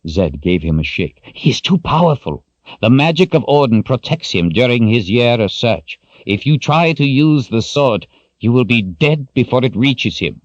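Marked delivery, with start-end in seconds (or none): 1.3-10.4 put-on high, louder grave warning from the old wizard; stern old wizard. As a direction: lay down the hard rule to him. Zed warns Richard.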